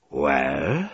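mildly menacing, rising question; threatening. A narrator's reading. Commodore challenging the delegate, with a hint of threat. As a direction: let a veiled threat hang on it. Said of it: "czuć było groźbę".

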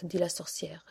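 plain reading voice

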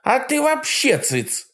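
high sharp hag snapping; barking old witch. A narrator's reading. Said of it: "рявкнула моя бывшая старушка".

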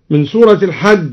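ordinary talk, nothing marked

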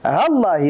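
"ها الله" said as one word, hallaahi; short "ها" running straight into the l of "الله"; ha joined to lam. Shown as a demonstration. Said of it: "الهاء متصلة باللام". (هاء «ها» متصلة بلام «الله»)

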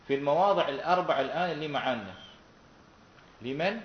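normal talking voice

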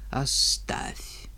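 hollow graveyard whisper; sepulchral whisper. Acted. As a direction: breathe it from the grave. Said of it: "Странный голос, прям замогильный".

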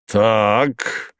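usual speaking voice

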